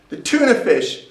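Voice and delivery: ordinary talk, nothing marked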